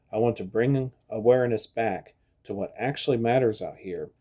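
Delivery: ordinary delivery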